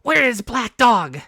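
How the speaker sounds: loud, high, slow anguished shout; waking in panic; frantic cry. A narrator's reading. Captain cries to the doctor in panic, loud and high.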